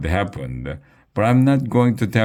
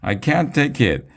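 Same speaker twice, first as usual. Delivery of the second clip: "I can't take it" wailed much higher, with a hammed-up cry; "I can't take it" pitched up like a mock tantrum; mock crying shout; 8 semitones higher than usual. A narrator's reading. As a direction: cry "I can't take it" in a high, near-tears wail. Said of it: "Miss Jojo was crying and shouting".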